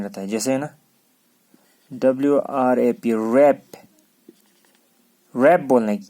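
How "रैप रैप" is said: रैप starting straight on R; silent W. Shown as a demonstration. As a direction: say रैप as "rap", no W. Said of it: "डब्ल्यू silent है".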